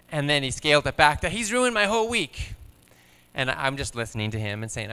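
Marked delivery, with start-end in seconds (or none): 1.3-2.5 put-on higher child voice, complaining; imitating sulky son. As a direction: pitch up into a hurt kid's grumble. Quoting upset son.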